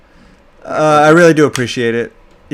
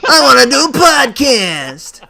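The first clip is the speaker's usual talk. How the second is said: high, drawn-out whining impression; mocking whiny voice; 10 semitones higher, 5 dB louder, much slower than usual. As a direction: whine it like a needy cohost. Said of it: "No, that's more like".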